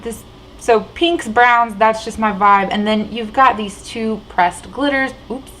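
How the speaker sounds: ordinary talk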